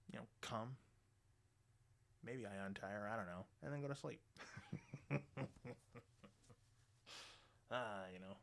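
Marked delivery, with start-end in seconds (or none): 4.2-6.0 breathy run of chuckles; long, mostly voiceless chuckling; laugh. Real, not acted. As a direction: laugh at your own story. Laughs at own story.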